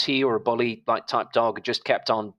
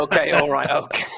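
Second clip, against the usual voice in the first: laughing; 4 semitones higher, 5 dB louder than usual. Real, not acted. Reacts to promised dog stories.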